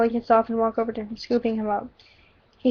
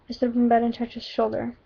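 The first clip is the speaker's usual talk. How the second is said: plain reading voice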